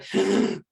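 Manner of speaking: a sudden first breath in; gasp. Retold or imitated. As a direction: gasp in as air finally comes.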